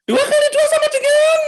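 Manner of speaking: put-on very high, loud yell drilling a child; mother yelling times tables. Neighbour's mother yelling math drills at her son, high and loud. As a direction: scream the sums at the kid. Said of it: "Sama persis pada saat dia ngajarin".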